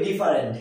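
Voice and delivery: "ডিফারেন্ট" said as "di-fa-rent", three syllables; common mispronunciation. Shown as a demonstration. Teacher showing what we usually say, "ডিফারেন্ট" as di-fa-rent. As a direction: say "ডিফারেন্ট" with a full "fa". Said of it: "আমরা এটাকে বলি".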